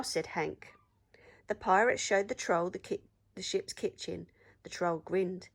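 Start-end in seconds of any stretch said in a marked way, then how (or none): none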